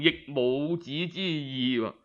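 usual speaking voice

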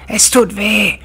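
high, very loud agonized wailing; screaming, howling. A narrator's reading. Ghost raging after drinking holy water, screaming in pain. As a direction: scream and howl in burning pain. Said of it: "tobte, schrie und heulte".

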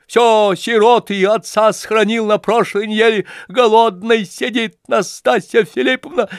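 very high, loud begging wail; wailing plea. Acted. Lebedev wailing to Nastasya Filippovna on his knees. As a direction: grovel and howl for mercy. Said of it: "И, провопив".

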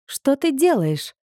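high, drawn-out protest; slow, high-pitched objection. A narrator's reading. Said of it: "запротестовала я".

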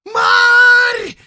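very high, piercing holler; squealing yell. Acted. Sutyrin calling Marya through the dusk, squealed shout. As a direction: screech the name at full volume. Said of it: "визжит Устин Сутырин".

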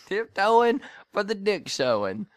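amused, giggling delivery; laughing. Real, not acted. Repeating a funny phrase, amused.

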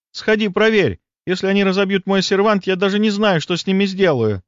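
high-pitched, indignant fretting; agitated woman. Acted. Лида sending him to check on her cabinet, agitated.

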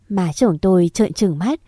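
usual speaking voice

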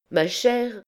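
high, stately address; solemn exclamation. A narrator's reading. Dame Aloïse addresses the dancer about her name, solemnly. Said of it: "s'écria solennellement dame Aloïse".

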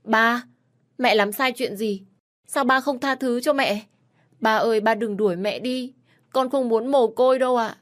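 put-on high, loud little boy begging; a son crying for his father's mercy; boy pleading with dad not to send mom away; pleading child. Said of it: "chạy đến năn nỉ".

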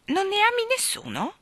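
hesitant. A narrator's reading. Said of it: "con una punta di esitazione".

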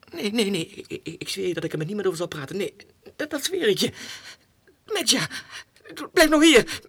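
loud, high urgent call; calling the fleeing dog loudly; calling after the dog. Acted. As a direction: cry out as it slips away. Dog runs off, he calls.

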